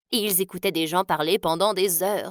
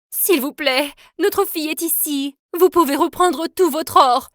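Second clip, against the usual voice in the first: higher, louder begging; a mother pleading high and loud to soldiers; desperate plea; 6 semitones higher, 5 dB louder than usual. Acted. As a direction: beg the soldiers for mercy.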